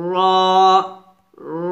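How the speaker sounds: র as a full, rounded "raw"; dark, thick র; thick heavy R. Shown as a demonstration. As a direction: make each র heavy and held. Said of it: "মোটা করে উচ্চারণ করতে হবে".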